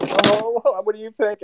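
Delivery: high, lightly voiced wife asking; hopeful wife voice. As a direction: ask sweetly for approval. Wife showing new haircut.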